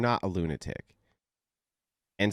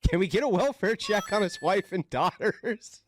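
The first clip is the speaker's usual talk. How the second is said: much higher mock-concerned lady; mocked online commenter; 10 semitones higher than usual. Lady demanding a welfare check, high mocking imitation. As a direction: repeat it in a high, incredulous put-on voice. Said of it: "This lady says".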